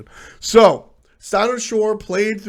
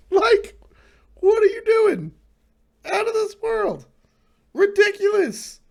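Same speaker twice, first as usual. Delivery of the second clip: much higher, squeaky incredulous voice; hammed-up amazement at the pickup; mock disbelief.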